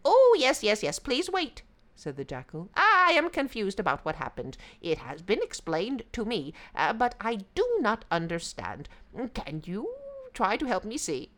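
high, yippy jackal voice; eager, playing dumb; sly yippy jackal.

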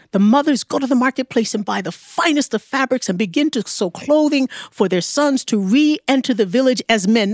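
ordinary delivery, nothing marked

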